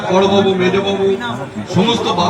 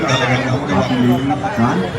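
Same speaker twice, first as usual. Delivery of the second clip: deep, slow film-star baritone; slow, heavy voice as if chewing paan; Amitabh Bachchan voice; 8 semitones lower, much slower than usual. Retold or imitated. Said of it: "অমিতাভের মিমিক্রিটা করার চেষ্টা করছি".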